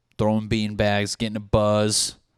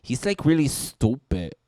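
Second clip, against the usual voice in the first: a hint of a Spanish accent, a bit higher; Spanish girl accent; 5 semitones higher, 4 dB louder than usual. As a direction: lightly imitate a Spanish girl's accent. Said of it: "the Spanish girl saying".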